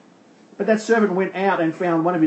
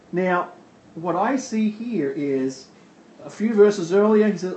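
normal talking voice

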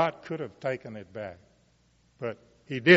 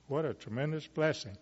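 ordinary talk

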